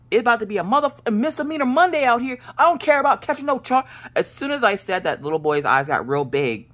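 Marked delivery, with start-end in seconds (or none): 0.1-3.8 riled taunt. Retold or imitated. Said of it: "I'm like".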